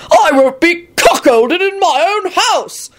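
high, drawn-out outraged cry; enraged scream. A narrator's reading. Jealous husband protesting before everyone, screamed. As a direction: wail it in blustering rage. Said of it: "he screamed".

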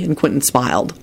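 ordinary delivery

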